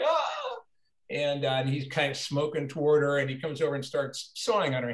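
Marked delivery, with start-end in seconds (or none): 0.0-0.6 very high mock scream of fright; shrill scream. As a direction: scream like a trapped horror victim. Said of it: "she's like".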